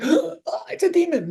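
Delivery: high-pitched gasp of pretend fright; mock scared. Medium denying she finds spirits scary, mock fright. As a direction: act spooked, not meaning it. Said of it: "It's not, like, scary. I'm not like".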